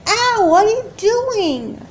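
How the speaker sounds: high, loud, slow wail of pain; hurt and frightened; pained cry. Acted. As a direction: draw out the ow in pain. Being hurt by sister.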